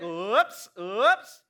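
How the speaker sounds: low, playful oops as Jesus; mock oops. Preacher voicing Jesus passing the struggling disciples, playful low oops. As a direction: tease it out, low and drawn.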